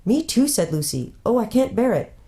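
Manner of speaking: high, distressed cry; can hardly bear it. Acted. Lucy overwhelmed by the pulling.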